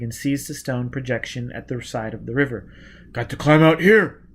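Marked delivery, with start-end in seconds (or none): 3.1-4.2 shouted. A narrator's reading. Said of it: "he shouted".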